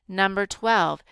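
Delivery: usual speaking voice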